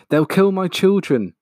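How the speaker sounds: high, loud anguished cry; cried out. Acted. Missus Fox fears for her children, high anguished cry. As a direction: wail it in terror for her young. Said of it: "cried Missus Fox".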